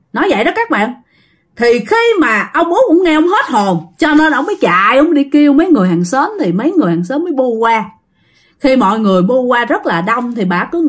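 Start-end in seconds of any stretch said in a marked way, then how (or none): none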